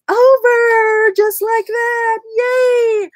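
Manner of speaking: high, loud little yay; happy cheer. Real, not acted. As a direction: cheer the finished fold. Cheering the fold.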